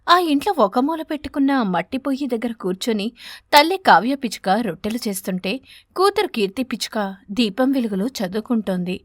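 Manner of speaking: plain reading voice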